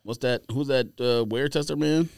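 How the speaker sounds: normal talking voice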